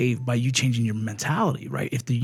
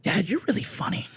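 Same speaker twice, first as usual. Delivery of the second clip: put-on high, soft child's voice; young daughter; 8 semitones higher, 4 dB quieter than usual. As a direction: lightly imitate a little girl talking to dad. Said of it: "my daughter, who's now fourteen".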